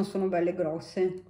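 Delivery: talking with food at lips; biting a chip while talking. Real, not acted. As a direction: mouth partly full. Seen 0: Shows chip while talking; bites it from 6.2s onward.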